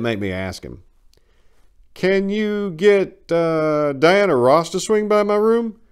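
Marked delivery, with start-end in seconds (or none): 1.9-5.8 high, loud mock-serious request; a cheeky patient teasing his nurse with a straight face; deadpan joke. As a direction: ask it straight-faced, pitched up and slow. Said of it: "keeping my face straight".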